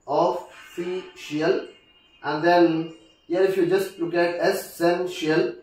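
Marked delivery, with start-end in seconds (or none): 0.1-1.7 "official" said slowly, syllable by syllable; broken into syllables. Shown as a demonstration. Teacher showing word stress, "official" slowly broken up. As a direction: draw out each syllable of "official". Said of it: "the second syllable it takes".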